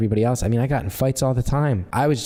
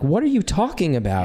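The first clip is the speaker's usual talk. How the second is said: imitating an appalled listener, pitched up; retold baffled question, higher voice; disturbed disbelief; 6 semitones higher than usual. College classmates reacting to hometown stories, disturbed. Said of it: "people would be disturbed".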